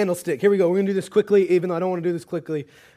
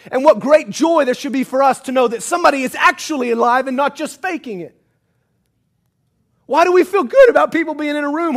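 loud, fervent preaching, rising in force; impassioned preaching; 5 semitones higher, 7 dB louder than usual. Acted. Preacher urging his church, fervent and loud. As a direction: preach it hard and loud to the room.

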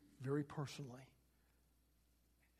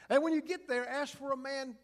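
emphatic instruction; 7 semitones higher, 4 dB louder than usual. Acted. The Lord instructs Ananias.

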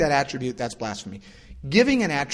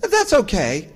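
put-on cheerful kid; 9 semitones higher, 7 dB louder, slower than usual. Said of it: "He said".

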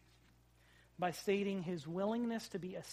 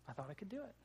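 put-on much softer, a hurt child's small voice, lightly done; softer mock tearful kid; 12 dB quieter, faster than usual. Dad repeating his son's tearful words, very soft. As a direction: say it quietly, as a little boy after crying. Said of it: "And in his tears".

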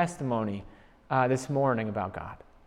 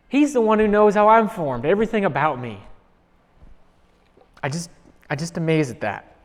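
normal talking voice

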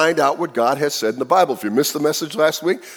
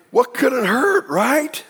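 high, carefree mock shrug; mock innocence; 8 semitones higher than usual. Pastor voicing Adam and Eve's careless shrug, high and light. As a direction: play it like tempting fruit is harmless.

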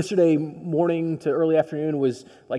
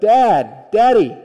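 put-on loud, high child's call for a parent; raised, urgent retold yell; 4 semitones higher, 9 dB louder, much slower than usual. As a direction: call out for Dad across a crowd. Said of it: "she yells out".

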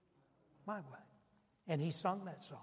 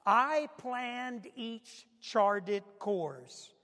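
each word drawn out slowly; deliberate, dragged-out reading; slow emphasis; 4 semitones higher, 4 dB louder, much slower than usual. Shown as a demonstration. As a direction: lean on every word of the line. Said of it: "Now listen to this next part".

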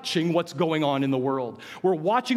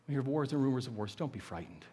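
soft corrected reading; 3 semitones lower, 8 dB quieter, faster than usual. Shown as a demonstration.

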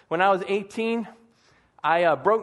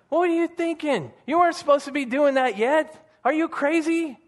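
high-pitched mock scolding from the surgeon, clearly raised; angry yell; 9 semitones higher, 3 dB louder than usual. Surgeon scolding a patient who reinjured his nose, high mock yell. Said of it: "Oh, he yelled at me".

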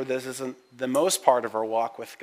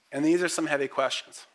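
ordinary talk

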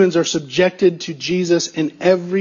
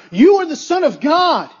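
raised-pitch retold outcry; crying out; 7 semitones higher than usual. Unclean spirit falling before Jesus, mildly crying out. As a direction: lightly act out a spirit's cry. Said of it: "cried out".

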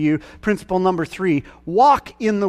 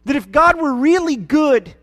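high, loud, building sermon cry; preaching at full force; 8 semitones higher, 4 dB louder, slower than usual. Acted. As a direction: preach it out, louder and higher each line.

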